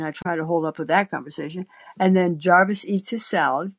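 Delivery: plain reading voice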